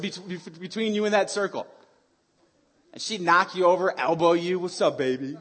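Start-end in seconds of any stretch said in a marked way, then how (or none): 4.6-5.4 lightly voiced tough gladiator, pitched low; a hint of a low taunting bruiser; Ice. Gladiator taunting the player she knocked over, lightly imitated. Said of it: "that's how she talked".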